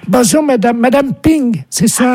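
high-voiced wife on the phone; put-on wife. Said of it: "C'est ma femme".

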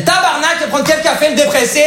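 put-on high, punchy Québécois accent. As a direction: throw it out in a broad Québécois accent. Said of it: "j'ai pris l'accent québécois".

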